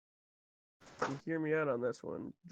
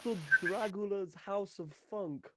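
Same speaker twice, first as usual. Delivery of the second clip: lightly voiced Dracula, higher, welcoming a crowd; Dracula host; 5 semitones higher, 4 dB louder than usual. Dracula welcoming the listeners to his funk house, campy. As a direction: play a campy Dracula greeting his guests. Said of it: "Name Dracula".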